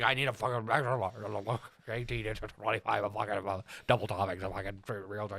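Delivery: imitated slurred, barely intelligible ranting; Suede, garbled demand. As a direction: garble the order in a sweary jumble. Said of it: "can you translate what you just said?".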